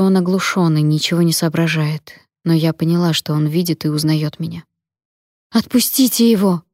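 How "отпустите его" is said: отчаянный крик, заметно выше; верещать, умоляя отпустить. A narrator's reading. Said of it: "верещала я". (испуганный крик)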